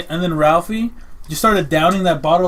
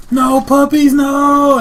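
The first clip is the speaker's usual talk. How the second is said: a much louder and higher No, puppies, no!; frantic yelling; 10 semitones higher, 9 dB louder, much slower than usual. Retold or imitated. Raul running at the puppies eating vomit, frantic shouting. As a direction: run in and shoo the dogs away, shouting. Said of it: "So he's running up like".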